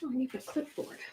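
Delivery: ordinary talk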